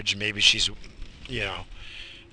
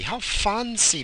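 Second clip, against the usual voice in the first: high, slow, prissy mock admiration; drawn-out high mock awe; mock fancy voice; 11 semitones higher, 4 dB louder, slower than usual. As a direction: coo it in a high mocking voice.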